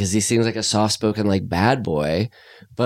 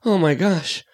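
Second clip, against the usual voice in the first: distraught father; 9 semitones higher than usual. Retold or imitated.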